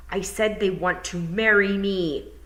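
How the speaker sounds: slow, weight on marry; insistent repeat. Acted. Tiffany repeating herself to the toad, slowly. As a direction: spell it out again, hitting marry.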